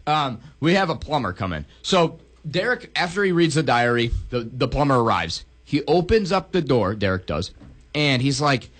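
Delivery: normal talking voice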